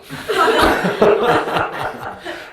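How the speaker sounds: a burst of high laughter; high unvoiced laughing fit. Real, not acted. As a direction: laugh hard at the punchline. Laughing at own story.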